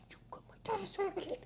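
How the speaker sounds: high soft teasing whisper; playful whisper. Acted. Therapist praising child playfully, high whisper. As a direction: breathe it out as a tease.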